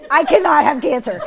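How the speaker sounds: very loud, high mock protest; comic retold outcry. Patient refusing the doctor's news, mock outcry. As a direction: cry out in hammed-up disbelief. Retells disbelief at diagnosis.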